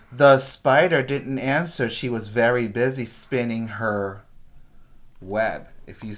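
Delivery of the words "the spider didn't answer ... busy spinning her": ordinary delivery, nothing marked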